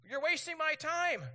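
hammed-up furious outburst; high, loud burst of impatience; acting out exasperated fury. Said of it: "I get furious, like".

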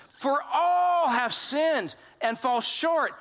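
"all" stretched long, high and forceful; slow right down and punch "all" hard; stress on all. Real, not acted. Preacher re-reading the verse to congregation, "all" drawn out emphatically.

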